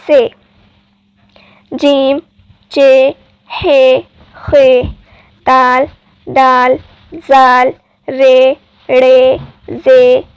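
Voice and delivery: slow, clear teacher recitation of each letter; alphabet recitation. Shown as a demonstration. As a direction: chant the letters out one by one for students. (वर्णमाला का धीमा पाठ)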